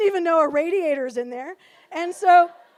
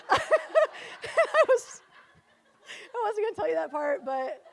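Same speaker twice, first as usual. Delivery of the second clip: ordinary delivery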